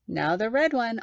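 ordinary delivery, nothing marked

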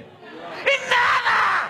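drawn-out high shout of "nothing"; man's blank answer. Acted. Husband answering his wife's question, a long high yell. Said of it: "¿Qué contesta el cien por ciento de los hombres?".

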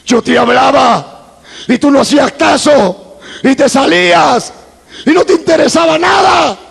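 high, climbing preacher's reproach; mounting reproach. Retold or imitated. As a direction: reproach forcefully, building toward a shout. Dios le reprocha. (reproche que sube hasta casi gritar)

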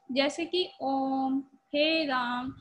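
ओम and राम held three times long; prolonged calling vowels in ओम, हे राम; pluta vowel demo. Shown as a demonstration. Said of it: "तीन गुना समय लग रहा है".

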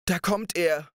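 high, alarmed shout of warning; a sudden scared call; frightened cry. Acted. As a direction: cry out in fear that he is coming. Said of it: "schrie die Frau".

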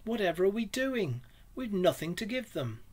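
high-pitched, anguished exclamation; despair. A narrator's reading. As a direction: cry out in helpless dismay. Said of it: "he cried in despair".

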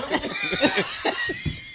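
howling laugh at his own story; high shrieking laugh. Real, not acted. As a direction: crack up hard, howling. Big laugh.

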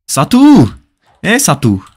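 high, loud shout of a name; sudden high-pitched holler; calling out. Real, not acted. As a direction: call the viewer out loudly, pitch jumping up. Calls out to a viewer.

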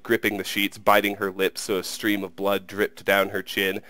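ordinary delivery

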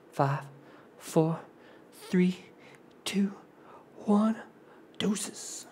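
fading whispered countdown. Real, not acted.

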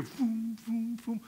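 whooshing beam-up noises; playful teleport sound effect; imitated whooshes. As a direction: make puffing zap sounds, one after another. Imitates people being beamed away.

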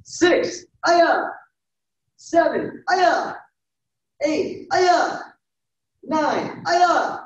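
high, punchy count with short kiai cries; loud yells. Real, not acted. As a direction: call the number, then yell a sharp kiai. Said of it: "Loud yells".

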